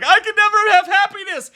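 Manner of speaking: very high, loud hammed-up wail; mock scream-cry. Reviewer mimicking the bereft movie hero, mock scream-cry. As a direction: imitate a melodramatic scream-cry at the top of the voice. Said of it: "He scream cries".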